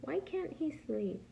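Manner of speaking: ordinary delivery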